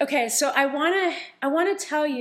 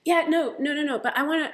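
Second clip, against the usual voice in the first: ordinary talk, nothing marked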